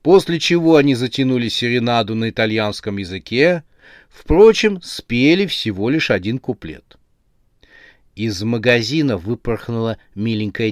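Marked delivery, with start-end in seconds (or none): none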